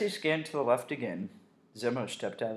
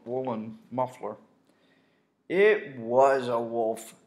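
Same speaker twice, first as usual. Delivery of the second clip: usual speaking voice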